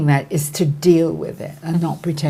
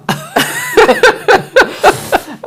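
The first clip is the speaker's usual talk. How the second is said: squealing giggle; high excited laugh; 7 dB louder than usual. Real, not acted. Excited laugh on phone story.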